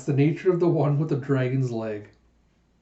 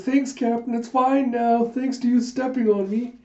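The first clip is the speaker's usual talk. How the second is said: much higher put-on voice; squeaky thanks; 9 semitones higher than usual. Pirate hastily thanking the captain, high squeaky voice. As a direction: pitch it way up in mock gratitude.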